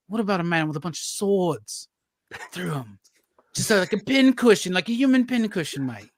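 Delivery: bad Dan Watters impression. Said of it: "That's a bad Dan Watters too".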